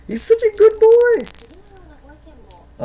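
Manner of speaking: very high, loud, drawn-out squeal; doting pet talk. Real, not acted. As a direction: gush over your dog in a squeaky falsetto. Baby-talks his dog, exaggerated second take.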